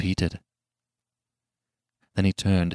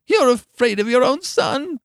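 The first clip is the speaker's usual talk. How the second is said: put-on high-pitched, accusing old woman's cry; cried out; 4 dB louder than usual. A narrator's reading. Wife struggling against her husband, high accusing cry. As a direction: throw it at him in a high cry. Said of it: "she cried".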